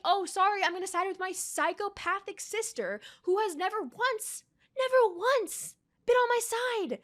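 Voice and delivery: high, sneering mock of Zuko; snide mimicry of the prince. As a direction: parody his excuse with contempt. Mocking Zuko's words.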